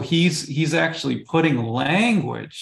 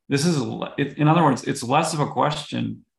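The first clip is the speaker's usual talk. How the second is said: normal talking voice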